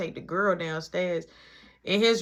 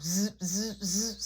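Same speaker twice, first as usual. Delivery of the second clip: phone buzz; 4 dB quieter, much slower than usual. Retold or imitated. Imitating phone vibrating.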